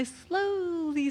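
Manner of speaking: SLOWLY stretched out long; drawn-out slowly. Retold or imitated. Skier telling how she creeps over the edge, slowly drawn out. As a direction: drag out the word slowly. Acting out her cautious start downhill.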